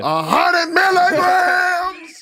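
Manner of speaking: high, long drawn-out shouted impression; mock shriek. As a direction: stretch it into a slow, high wail. Group repeats the mocking impression.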